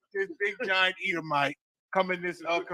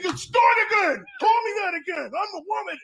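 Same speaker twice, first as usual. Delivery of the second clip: much higher, loud hammed-up fury; mock angry shout; 11 semitones higher, 4 dB louder than usual. Retelling the angry customer.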